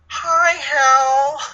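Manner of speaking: high, loud mocking villain voice; hammed-up creepy villain hello; mock Hector Hammond. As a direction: squeak out a goofy villain greeting. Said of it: "it's like".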